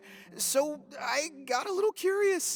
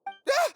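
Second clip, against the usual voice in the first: startled yelp; 13 semitones higher, 5 dB louder than usual. Acted. Culprit caught by surprise.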